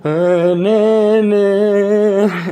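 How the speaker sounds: loud, high, stunned nah-nah; shocked girl. Retold or imitated. Girl on the plane recoiling at the bloody face, loud and high. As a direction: back away in horror, loud and high.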